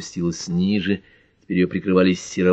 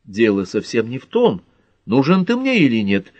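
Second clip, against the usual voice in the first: ordinary delivery, nothing marked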